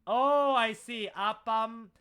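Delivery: mock British accent, slowed down; imitating a British tourist. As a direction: drawl it out like a puzzled Brit. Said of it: "when a British person translate".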